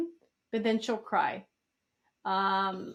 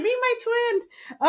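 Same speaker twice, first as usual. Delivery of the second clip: put-on high, drawn-out kid's plea; mock childlike coaxing; imitated pleading child; 12 semitones higher, 4 dB louder, much slower than usual. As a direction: beg sweetly in a put-on high voice. Voicing a child's request.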